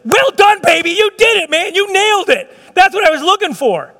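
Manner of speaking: overjoyed praise, high and loud; excited Jesus. Pretend. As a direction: cheer the believer on like a thrilled coach. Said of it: "I can't tell you how excited I am".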